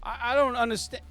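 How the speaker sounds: high, mocking snarl; sarcastic mob boss. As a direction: sneer at the player's obvious answer. GM voices Sal.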